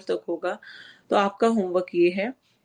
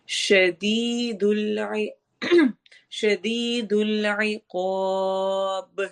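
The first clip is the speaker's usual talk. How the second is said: slow, drawn-out tajweed chant; slow Quran recitation; much slower than usual. Shown as a demonstration. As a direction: recite it measured and stretched. Recites the verse. (آہستہ تجوید کے ساتھ تلاوت)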